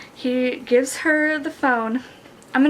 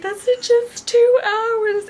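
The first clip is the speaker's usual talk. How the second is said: high, drawn-out squeal of protest; mock panic, voice way up and slow; hammed-up dismay; acted alarm; 10 semitones higher, 3 dB louder, slower than usual. Acts her alarmed protest at deadline.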